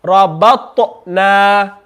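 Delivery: slow Rabatna, t with a tiny a after it; echoing ta. Shown as a demonstration. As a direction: give the t in Rabatna a third of a vowel. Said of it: "ta has a third of one fatha".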